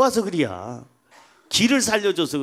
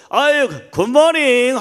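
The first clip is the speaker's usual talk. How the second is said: high, loud, slow good-morning call; hearty, confident greeting; 7 semitones higher, 8 dB louder, slower than usual. Acted. Confident husband greeting colleagues at work, hearty drawn-out greeting. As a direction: greet the office with showy confidence. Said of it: "씩씩하게 어디 가서 눈치 안 보고".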